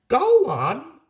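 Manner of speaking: urging. A narrator's reading. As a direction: press him to keep telling. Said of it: "urged Peter Sam".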